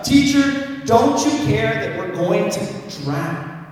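high, loud cry for help, falling off on the last word; shouted plea. A narrator's reading. Disciples waking Jesus in the filling boat, shouting. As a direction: call out to the teacher in alarm.

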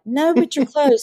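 high, teasing reply; playful retort. Retold or imitated. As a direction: tease her with a knowing lilt. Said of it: "And I'm like".

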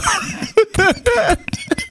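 high, breathless fit of laughter; uncontrollable laughter. Real, not acted. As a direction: burst out laughing and keep going. Reader cracks up mid-post.

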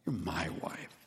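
soft and low, tenderly to his wife; a husband's tender reassurance. Retold or imitated. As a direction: say it gently, arm around her. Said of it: "tenderly put his arm around her".

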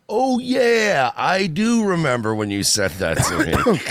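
high, overly bright fake "oh yeah"; forced fake agreement. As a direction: pretend to remember, badly. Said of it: "it's so fucking forced".

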